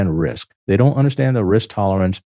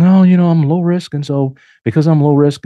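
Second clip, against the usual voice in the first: mocking cautious client; 6 semitones higher, 4 dB louder than usual. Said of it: "They're just saying".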